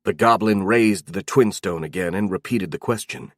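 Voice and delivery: usual speaking voice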